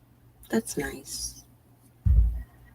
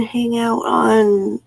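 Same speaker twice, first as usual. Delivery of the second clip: drawn-out slow words; slow drawl while reading chat; stretched thinking; 6 semitones higher, 4 dB louder, much slower than usual. Real, not acted. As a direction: stretch each word while thinking it over.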